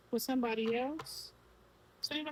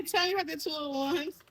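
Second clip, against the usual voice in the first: higher-pitched, said through real laughter; laughing; 7 semitones higher, 4 dB louder than usual. Real, not acted. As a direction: keep talking while giggling. Laughing while asking the cards.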